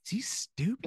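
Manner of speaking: mock gossip. Interns gossip in the break room.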